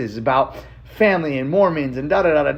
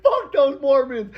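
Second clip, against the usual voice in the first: much higher, slow, relishing each word; gleeful defiance; 13 semitones higher, slower than usual. Real, not acted. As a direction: savour it, slow and high. Thrilled by grandpa story.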